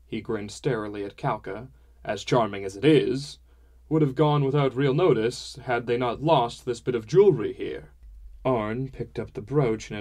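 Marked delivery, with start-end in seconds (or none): 2.0-7.9 a hint of a hearty, talkative father; father's voice, mildly put on; lightly voiced father. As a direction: lightly play a father holding forth, a little raised.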